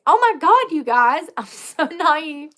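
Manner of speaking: high-pitched, pearl-clutching gasp of disbelief; mock shocked. Storyteller re-enacting her shock to viewers, high and scandalized. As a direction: jump up in scandalized shock, breaking into a laugh. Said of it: "I was clutching my pearls".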